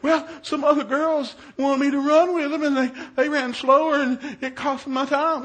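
lightly voiced upset girl, loud and plaintive; whiny daughter. Tearful daughter explains herself.

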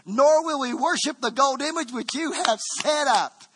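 high, loud, ringing preacher's cry; full-force preaching. Acted. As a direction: preach it out over the crowd.

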